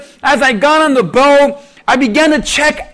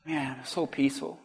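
peaceful sigh; 11 semitones lower, 19 dB quieter than usual. Retold or imitated. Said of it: "I had peace again".

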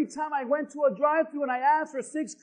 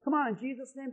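usual speaking voice

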